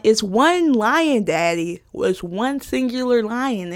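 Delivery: "one" drawn out and stressed, correcting Daddy; slow, exasperated stress on "one"; emphatic correction. Retold or imitated. Correcting dad's plural, stressing one.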